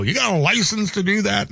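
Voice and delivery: imitating the caller's gruff tease; lightly voiced teasing driver; gruff pickup driver. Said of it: "put the voice with the face".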